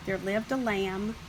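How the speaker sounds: ordinary delivery, nothing marked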